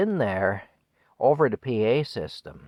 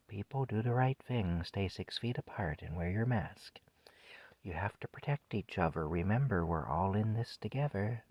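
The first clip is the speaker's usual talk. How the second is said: hushed, soothing imitation of a PA recording; soothing announcer; 7 dB quieter than usual. Man imitating the store's PA reminders to shoppers, hushed and soothing. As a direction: murmur gentle reminders like a background loop. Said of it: "it was this soothing woman's voice".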